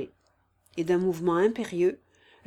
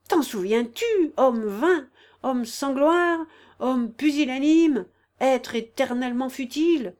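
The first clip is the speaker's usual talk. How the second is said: slow, loud, raised denunciation; violent irritation; 5 semitones higher, 7 dB louder than usual. A narrator's reading. Woman shakes the pillow and berates him, violent irritation. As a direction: spell out each insult slowly with piercing anger. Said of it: "d'un ton bas, mais où perçait l'irritation".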